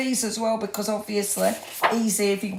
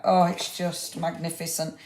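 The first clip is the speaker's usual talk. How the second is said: usual speaking voice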